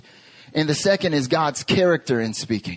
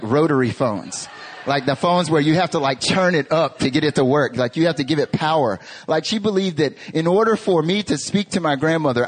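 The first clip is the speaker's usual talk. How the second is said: ordinary talk, nothing marked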